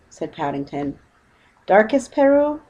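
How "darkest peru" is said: high, louder impressed echo. Acted. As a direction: repeat it, wide-eyed. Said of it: "repeated the boatman, looking most impressed".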